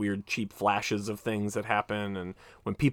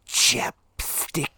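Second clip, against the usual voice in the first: whispered chapstick; 3 semitones higher than usual. Retold or imitated. Quoting the film's punchline.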